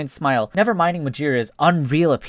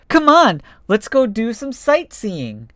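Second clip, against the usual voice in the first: much higher, slower at the end; eager; 8 semitones higher, slower than usual. Acted. Fox urging his friend to go sightseeing, eager and high. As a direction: pull your friend along.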